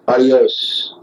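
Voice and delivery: ordinary talk, nothing marked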